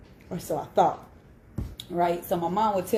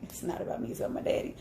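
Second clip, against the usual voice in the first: whispered aside; 5 semitones higher, 7 dB quieter, much faster than usual. Real, not acted. Woman catching herself off topic, whispering with a chuckle. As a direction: whisper it softly with a laugh.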